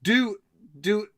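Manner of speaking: ordinary talk, nothing marked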